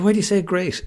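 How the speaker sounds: retold incredulous question, pitched high; mock-baffled, high-pitched challenge; baffled protest. Husband questions wife's reply to his nerves, baffled protest.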